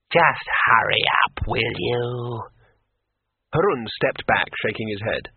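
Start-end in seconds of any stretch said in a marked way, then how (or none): 0.1-2.4 eerie graveyard drone, impatient; Graiture. A narrator's reading. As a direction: nag from beyond the grave, drawing out the end. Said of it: "said Graiture's graveyard voice".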